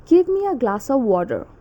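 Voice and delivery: attempted American accent, "water" ending in a full r; American rhotic "water". Shown as a demonstration. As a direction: request it American-style, sounding the r in "water". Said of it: "And in American accent".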